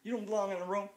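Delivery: mock angry driver.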